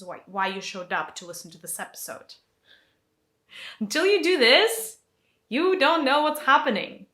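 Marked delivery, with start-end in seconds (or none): 3.8-6.4 loud, higher burst of conviction; emphatic declaration. Real, not acted. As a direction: proclaim it with fervour. Emphatic climax of lesson.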